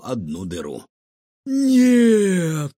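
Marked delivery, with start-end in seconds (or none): 1.5-2.7 high, loud, long wail; despairing cry. A narrator's reading. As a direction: cry out in horror. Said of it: "схватился за голову управляющий".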